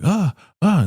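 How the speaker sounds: short high, loud "ah" cries; imitated cries. Teresa being dragged to the highest, imitated cries. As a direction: mimic someone crying out while being dragged. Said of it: "all they hear is like".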